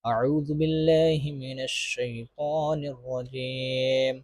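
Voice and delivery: slow, soft tajweed recitation, the last word sung out long; Quran recitation. Shown as a demonstration. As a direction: recite it in a measured, melodic chant. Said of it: "چلو ہم پڑھتے ہیں اب".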